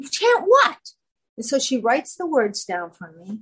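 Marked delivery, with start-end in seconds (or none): 0.1-0.8 very high, incredulous retold question; mock baffled. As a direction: squeak up in exaggerated puzzlement. Retelling her baffled question back.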